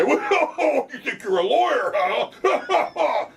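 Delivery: high, laughing sneer of a cop; mock a cop's sarcasm while laughing. Lawyer mocking a cop's jab, laughing and high. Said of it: "the police might say".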